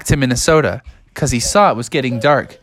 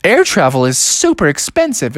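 higher amused clerk voice; airport clerk; 8 semitones higher than usual. Acted. Airport desk clerk tells Bubby tickets cost money, high and teasing. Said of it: "And they said".

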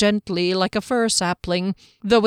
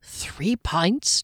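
unvoiced inner question; whispered thought; 4 dB quieter than usual. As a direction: whisper it to yourself. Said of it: "Juggler thought".

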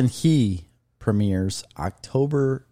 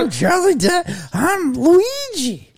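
high squeaky Luigi voice; 3 dB louder than usual. Pretend. Imitating Charlie Day as Luigi.

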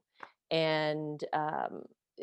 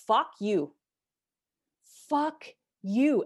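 loud, high yell, climbing higher on the second; retold furious scream; 7 semitones higher, 6 dB louder, much slower than usual. Breaking down, screaming at her vice principal, furious yell. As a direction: hurl it at him, losing it completely. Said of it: "I just started screaming at him".

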